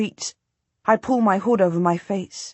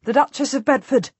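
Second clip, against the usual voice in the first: higher, raised call up to the wall; calling back; 6 semitones higher than usual. A narrator's reading. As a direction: call your name up to the guards. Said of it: "I call back".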